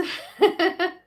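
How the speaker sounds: bright high burst of laughter. Real, not acted. Laughing at troll comment.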